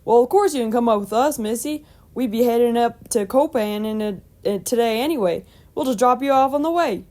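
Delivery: put-on hearty, reassuring old farmer; Lone. A narrator's reading. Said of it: "assured Lone".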